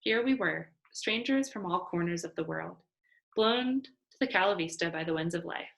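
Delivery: plain reading voice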